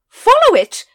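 sudden high, very loud command; shouted order. Acted. Lady Ramkin urging the watch after the dragon, shouting. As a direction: yell it out to chase the dragon. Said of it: "shouted Lady Ramkin".

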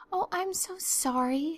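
put-on high, slow, sorry-sounding girl; penitent. A narrator's reading. Anne apologises to Marilla for the forgotten pie, penitent. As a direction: apologise with a high, drawn-out plea. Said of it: "said Anne penitently".

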